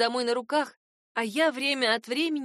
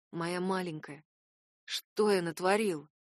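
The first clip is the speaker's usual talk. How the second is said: тихо, с горечью; 5 semitones lower, slower than usual. Acted. Раскаяние призрака над героиней.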